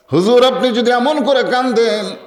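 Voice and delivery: high-pitched, chanted preacher's plea; impassioned plea. Acted. Acted plea to the weeping Prophet. (উঁচু সুরে আবেগভরা মিনতি)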